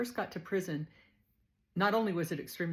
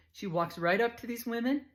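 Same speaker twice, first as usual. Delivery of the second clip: ordinary talk, nothing marked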